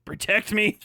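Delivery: normal talking voice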